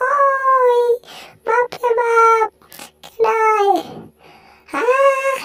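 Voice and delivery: high, excited cartoon mouse, squealing and fussing; squeaky mouse voice. Mouse finding food then scolding the sleepy cat, high squeaky voice. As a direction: play a tiny panicky mouse, high up.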